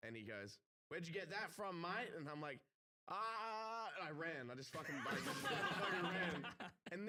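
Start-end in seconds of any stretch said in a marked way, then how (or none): 0.9-2.1 higher-pitched challenge from a stranger; accosting worker. Retold or imitated. Construction worker confronts the drunk man holding his hat, imitated challenge. 3.1-3.9 high, drawn-out mock yelp of alarm; panicked yelp. Drunk man caught by the worker before running, mock panicked yelp.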